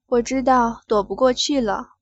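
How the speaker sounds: ordinary delivery, nothing marked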